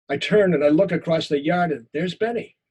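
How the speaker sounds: usual speaking voice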